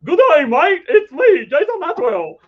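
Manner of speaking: high, loud hammed-up Australian; mock Australian accent. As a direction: play a cheery Aussie bloke. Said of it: "That's what you sound like".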